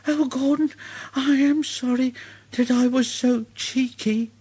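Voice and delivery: a small engine owning up; Thomas sorry. Acted. Thomas apologises.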